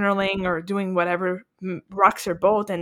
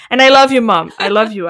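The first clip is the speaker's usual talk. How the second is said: calling out fondly; 10 dB louder than usual. Real, not acted. Calls out to her mom.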